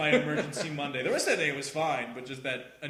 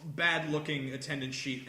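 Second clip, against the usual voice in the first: ordinary delivery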